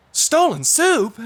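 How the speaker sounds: Mister Guinea shouts soup in surprise; loud alarmed. A narrator's reading. Husband loudly shocked by the detective, saying soup loud. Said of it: "Mister Guinea says loudly".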